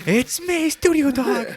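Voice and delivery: very high cartoon dog voice. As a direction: give the dog a squeaky little voice.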